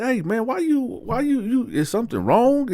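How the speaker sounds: high-pitched innocent questioning; playing innocent. Man acted, playing innocent.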